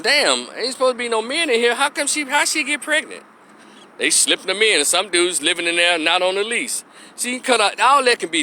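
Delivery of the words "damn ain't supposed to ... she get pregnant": high mocking voice of the residents; scoffing high-pitched quoted voice; mocking quote. Neighbors gossiping about the pregnancy, high and mocking. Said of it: "They said".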